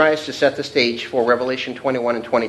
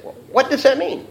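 pointed questioning; 12 semitones higher than usual. Acted. Acting out questioning a coworker.

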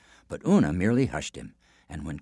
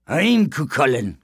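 growl; 6 semitones higher, 5 dB louder than usual. A narrator's reading. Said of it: "growled the giant".

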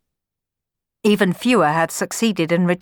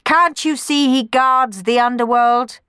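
higher, louder shriek; raised, shrill Furies voice; screaming Furies; 7 semitones higher, 5 dB louder than usual. A narrator's reading. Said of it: "screamed the Furies".

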